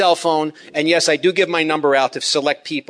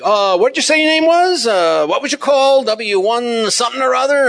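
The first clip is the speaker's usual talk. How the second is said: high, loud mock of a forgetful ham; mocking a caller who missed the name; clueless caller; 9 semitones higher, 4 dB louder than usual. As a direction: squeak it out like someone who wasn't listening. Mocking a caller who didn't listen.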